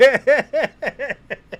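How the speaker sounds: high, rapid run of laughter; long, high-pitched real laugh. Real, not acted. Streamer cracking up over the FBI, high laugh.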